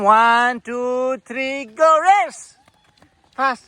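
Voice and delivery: playful race countdown; excited countdown. Acted. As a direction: count him off eagerly, then launch. Starting a race with toddler.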